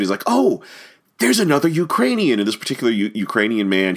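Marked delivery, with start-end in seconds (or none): 0.3-2.4 high-pitched imitation of a delighted listener; retold delight. Ukrainian audience man delighted to find a compatriot, mock-excited. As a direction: act out thrilled surprise, voice jumping up. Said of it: "was so taken by this accent".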